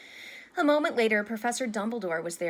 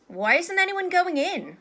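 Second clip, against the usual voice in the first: high, louder puzzled question; curious; 10 semitones higher, 7 dB louder than usual. A narrator's reading. Ron finding the corridor jammed with students, curious. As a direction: ask with open curiosity. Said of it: "said Ron curiously".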